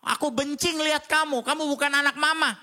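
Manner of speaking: mother scolding her son, imitated angrily; angry mother. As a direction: play the furious mum throwing her kid out. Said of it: "Ada ibu yang ngomong gini".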